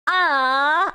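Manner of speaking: ordinary delivery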